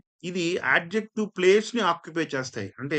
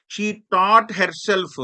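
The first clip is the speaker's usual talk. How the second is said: slow, louder and higher classroom reading; slow loud example reading; 5 semitones higher, 7 dB louder, much slower than usual. Shown as a demonstration. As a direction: project the example sentence slowly. Teacher reading example sentence. (నెమ్మదిగా గట్టిగా ఉదాహరణ చదవడం)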